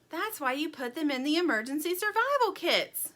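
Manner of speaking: sudden realization. A narrator's reading. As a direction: let the penny drop aloud. Sweetie realizes aloud.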